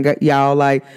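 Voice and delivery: ordinary talk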